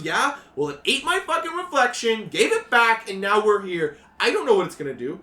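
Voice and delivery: put-on frantic teen rant. GM voices scared teen.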